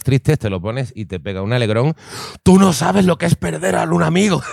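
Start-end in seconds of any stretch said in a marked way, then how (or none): 2.4-4.4 high, loud hammed-up soap-opera cry; mock dramatic yell. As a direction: yell it like a melodramatic actor. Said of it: "le grita al robot".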